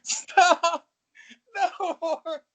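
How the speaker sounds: high pleading through laughter; laughing through pain. Real, not acted. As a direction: beg them to stop while laughing hard. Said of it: "It hurts to laugh".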